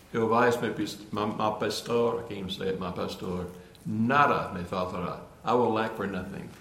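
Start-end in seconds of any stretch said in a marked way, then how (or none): 0.1-5.2 Psalm read in Spanish, stumbling; quoting the psalm in halting Spanish. Real, not acted. Preacher to congregation, reciting the psalm in faltering Spanish. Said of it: "I love it in Spanish".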